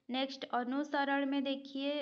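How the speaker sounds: ordinary talk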